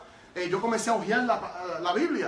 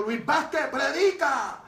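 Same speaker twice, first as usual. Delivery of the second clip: evangelist's command; 6 semitones higher, much slower than usual. Retold or imitated. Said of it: "y solamente dijo".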